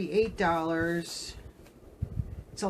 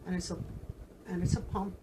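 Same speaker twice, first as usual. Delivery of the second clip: usual speaking voice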